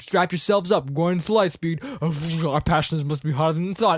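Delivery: mock-urgent movie callout; urgent pilot orders. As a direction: warn the passengers over the noise. Pilot's orders before light speed.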